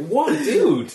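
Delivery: loud, high, amused incredulity; mock disbelief. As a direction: act out a baffled reaction. Retells disbelief while laughing.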